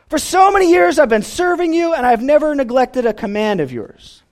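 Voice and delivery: put-on bitter older brother complaining to his father; resentment. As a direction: whine it like a wronged elder son.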